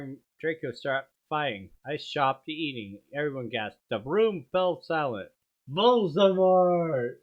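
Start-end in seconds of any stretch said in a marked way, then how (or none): 5.7-7.2 drawn-out, higher, louder name, very slow; dramatic shout. A narrator's reading. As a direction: call the villain's name in full horror. Dramatic reveal of the villain.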